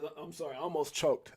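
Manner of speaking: ordinary delivery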